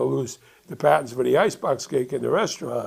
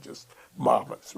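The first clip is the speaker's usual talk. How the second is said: normal talking voice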